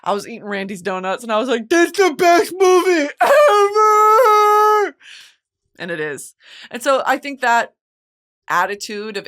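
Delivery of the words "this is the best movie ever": high, loud, drawn-out mock wail; hammed-up tearful shriek of adoration; overwhelmed outburst. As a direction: wail it as if sobbing with joy. Reenacts emotional outburst while on edible.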